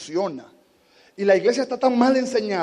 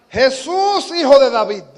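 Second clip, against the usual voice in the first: high, louder cry to Jesus; 7 semitones higher, 11 dB louder, slower than usual. Retold or imitated. Bartimaeus crying out again to Jesus. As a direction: call out desperately for mercy. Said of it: "clamó tan fuerte".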